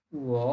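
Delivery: উও dipping low then rising; উও in the broken third tone; Mandarin third tone. Shown as a demonstration. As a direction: break উও with a low dip. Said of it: "third tone, এই জন্য ভেঙে বলতে হবে".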